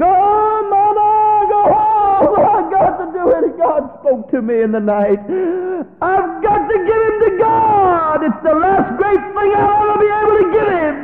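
high, loud, strained declaration; a father telling his wife he must obey God; anguished shout. Acted. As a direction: cry it out, torn but resolved.

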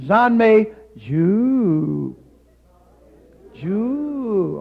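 loud, slow "Zan Mei Ju" with "Ju" long and swooping; third tone demo. Shown as a demonstration. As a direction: lead the crowd through a stretched, bending "Ju". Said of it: "Try it".

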